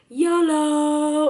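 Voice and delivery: loud, high, drawn-out whoop; imitating gleeful baby. Baby letting go to fall backwards, mock reckless whoop. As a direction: holler it high like a fearless daredevil. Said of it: "he would kinda just be like".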